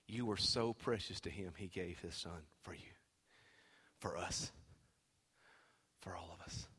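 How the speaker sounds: very soft, low appeal with long pauses; hushed preaching. Real, not acted. Preacher consoling a hurting congregation, hushed and low. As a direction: speak low and tenderly to the struggling.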